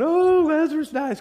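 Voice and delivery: high, loud exaggerated wail; hammed-up lament for a dead friend; mock grief. Preacher mimics grief over friend Lazarus, mock wailing. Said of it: "Not with tears of grief".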